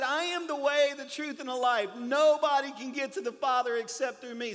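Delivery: loud, high, proclaiming scripture. Acted. Pastor proclaiming Jesus's words to the congregation. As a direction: declare the verse with full force.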